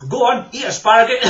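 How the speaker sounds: usual speaking voice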